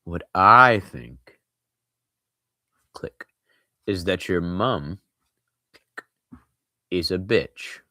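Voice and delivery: slow, deliberate words with pauses, savoring each one; 천천히 또박또박 끊어서; slow and careful. Acted. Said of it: "talking slowly and carefully".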